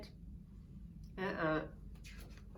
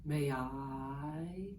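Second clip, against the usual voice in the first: slow, low whispered snake; sly snake; 7 semitones lower, much slower than usual. Acted. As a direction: hiss it slyly like a snake. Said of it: "Snake asked slyly".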